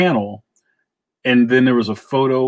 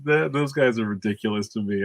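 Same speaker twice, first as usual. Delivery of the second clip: usual speaking voice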